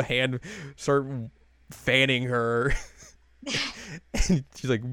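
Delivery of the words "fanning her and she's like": ordinary talk, nothing marked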